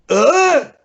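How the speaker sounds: loud, high mimicked grunt; Big Show's grunt. Wrestler asking the audience for another hit, imitated loud grunt. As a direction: shove out a big wordless grunt. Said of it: "the only way he can communicate".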